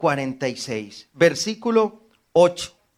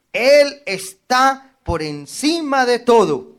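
proclaiming loud; 7 semitones higher, 7 dB louder, slower than usual. Real, not acted. Proclaims scripture.

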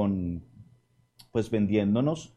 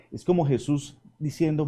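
usual speaking voice

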